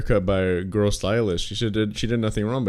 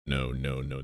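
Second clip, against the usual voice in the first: ordinary delivery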